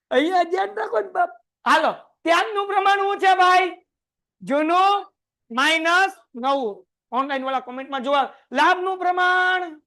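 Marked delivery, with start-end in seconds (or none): 1.6-6.9 shouting the question. Real, not acted. Teacher shouting the formula question to the class. 8.5-9.8 high, slow call to recite; drill call. Real, not acted. Teacher drilling the class, high and slow.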